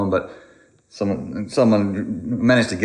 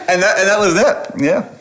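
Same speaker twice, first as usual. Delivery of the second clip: laughing; 11 semitones higher than usual. Real, not acted. Laughing while finishing the story.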